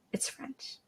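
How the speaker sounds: a quick whispered aside; whispering. Pretend. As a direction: drop to a whisper. Whispered aside about French.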